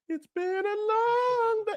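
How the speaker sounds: very high, slow mock Dom. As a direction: drag it out in a silly squeaky parody of Dom. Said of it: "Didn't he just drive up the road?".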